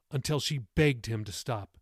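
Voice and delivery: usual speaking voice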